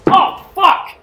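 high, loud curse; real startled outburst; startled yell. Real, not acted. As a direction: yelp it out loud. Trips while raging at game.

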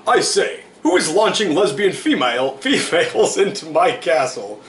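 high, loud-ish indignant monarch; King Vitamin. A narrator's reading. King demanding answers in his castle, indignant royal voice. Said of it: "King Vitamin asked".

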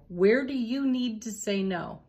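ordinary talk, nothing marked

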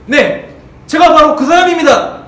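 loud, slow and proud, with the weight on 제가; bold confession. Pretend. Peter boldly owning that he knows Jesus, loud and proud. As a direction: own up proudly and loudly. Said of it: "그럼 베드로가 뭐라고 했을까요?".

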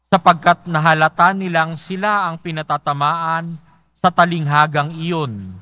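ordinary talk, nothing marked

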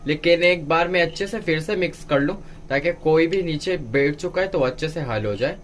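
ordinary delivery

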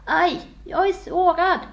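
high, loud, slow cry of hurt; wounded king's outburst; pained exclamation. A narrator's reading. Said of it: "tog han sig för sidan och utbrast".

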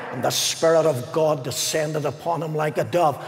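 ordinary talk

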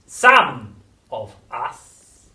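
very slow, throaty, menacing crone; throaty witch whisper. A narrator's reading. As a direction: lean in and menace. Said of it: "whispering in a throaty sort of voice".

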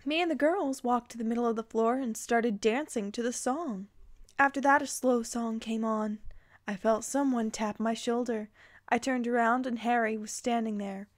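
ordinary talk, nothing marked

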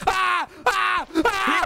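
short high-pitched screams; panicked yelps. Pretend. Player panicking at chaos in the game, short high screams. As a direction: yelp in hammed-up panic.

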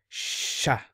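sha breathed out in a long voiceless ʃ; a slow, hushed sha with a long ʃ; demo sha. Shown as a demonstration. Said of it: "So this is different from".

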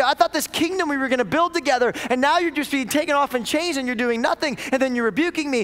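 high, pressing, bewildered protest; hurt confusion pushed out; let-down follower voicing betrayal; disciple's complaint. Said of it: "They go".

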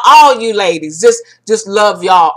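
usual speaking voice

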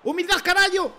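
high, loud boast; triumphant shout. Real, not acted. Streamer shouts in triumph after the goal. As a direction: bellow it out like a victory cry.

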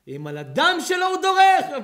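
high, loud, slow cry; preaching shout. Acted. Preacher rebukes brothers who betray blood, loud slow cry. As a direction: cry it out to the congregation.

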